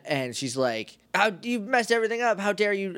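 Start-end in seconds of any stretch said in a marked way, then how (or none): 1.1-2.9 high-pitched angry outburst, retold; hammed-up indignant scolding; mad. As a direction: imitate an outraged villainess telling someone off. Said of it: "and she's mad".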